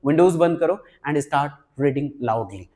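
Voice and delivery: ordinary talk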